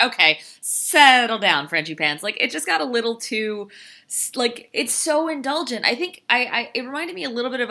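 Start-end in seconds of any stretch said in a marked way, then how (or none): 0.0-2.2 drawn-out, louder, teasing Okay, settle down; mock exasperation. Critic mocks the film's sappy tribute, exasperated. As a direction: roll your eyes at the movie. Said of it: "I was like".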